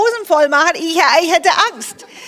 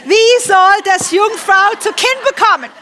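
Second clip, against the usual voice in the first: higher, louder mock-indignant question; cheeky retort; 6 semitones higher, 5 dB louder than usual. Preacher voicing cheeky Maria questioning the angel, sassy and raised. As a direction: play Maria talking back to the angel, sassy. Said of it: "sie war frech".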